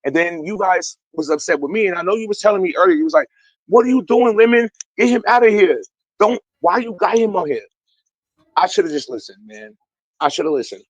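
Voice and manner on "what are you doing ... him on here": mock exasperated scolding. Retells friend scolding him.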